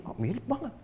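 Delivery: ordinary talk, nothing marked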